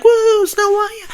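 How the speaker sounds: a high, slow, hammed-up crowd cheer; mock cheer. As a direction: stretch out a big mock "woo" like a cheering crowd. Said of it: "everyone's like".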